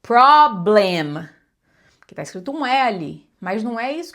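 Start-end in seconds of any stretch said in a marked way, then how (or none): 0.0-1.3 PROBLEM in two loud, drawn-out full syllables; over-pronounced "problem", no reduced vowel. Shown as a demonstration. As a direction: say "problem" slowly, both vowels clearly sounded. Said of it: "eu tô esperando ouvir uma palavra".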